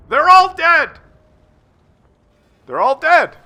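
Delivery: high, loud, building to shouting; frantic excitement as the team falls; climactic play-by-play. Real, not acted. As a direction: call the finish like a sportscaster. Climactic finish of the match.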